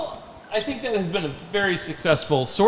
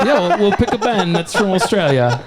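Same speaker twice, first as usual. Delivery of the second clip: loud, laughing attempt at Australian; mock Australian accent; 3 semitones higher, 7 dB louder than usual. Bandmate choosing a band to cover, loud mock Australian accent. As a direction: push the fake Australian accent through laughter. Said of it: "Australia-ish? What's going on?".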